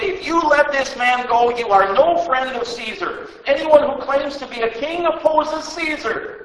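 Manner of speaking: high, loud threatening yell; shouting. Acted.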